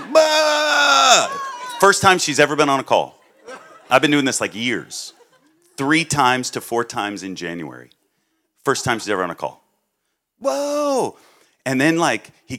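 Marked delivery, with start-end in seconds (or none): 0.0-1.3 imitating a loud, much higher whoa; excited whoa. Heidi exclaiming on the call, amazed and loud. 10.4-11.2 imitating a loud, much higher whoa; excited whoa. Heidi exclaiming on the call, amazed and loud.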